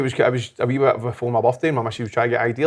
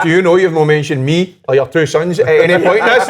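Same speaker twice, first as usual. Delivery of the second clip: higher, louder mock indignation with a laugh; wife's indignant complaint; 5 semitones higher, 10 dB louder than usual. Wife complaining she was left out of his perfect day, a louder, higher mock-indignant voice. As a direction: play the offended wife, laughing through it. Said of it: "she went".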